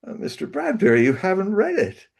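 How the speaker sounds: high, meek objection; the nervous young writer daring to push back; timid protest. Retold or imitated. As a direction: squeak it out, unsure of yourself.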